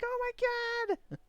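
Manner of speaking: high-pitched mock gasp of dismay; squeaky, play-acted horror; child's shock. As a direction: imitate a little kid aghast.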